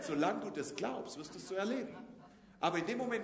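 ordinary talk

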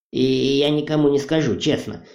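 plain reading voice

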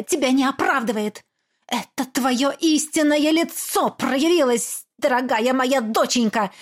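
high, scornful tirade; furious mother. Acted. Mother attacking her daughter, high and scornful.

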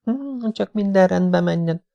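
high, soft worried sigh of a wife. Acted. Kim sighs softly to her husband, worried. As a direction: breathe it out, hoping all goes well. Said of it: "sóhajtott Kim".

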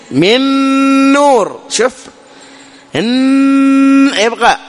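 إن يبقى slow and high, the noon held long with a nasal hum; full ghunna. Shown as a demonstration. Teacher contrasts full nasal hum, drawn out and high. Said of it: "بخلاف الغنة الكاملة".

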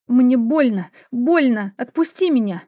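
loud, pained pleading shout; desperate shout. Acted. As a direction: scream to be let go. Struggling against his grip.

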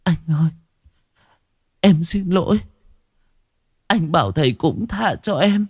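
high, quivering apology; on the verge of tears. Acted. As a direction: apologise about to cry. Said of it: "Sao mếu máo".

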